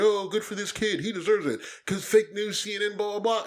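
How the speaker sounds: a hint of mimicked crowing, trailing into blahs; Trump. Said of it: "Trump is gonna go on Twitter and he's gonna throw it out there".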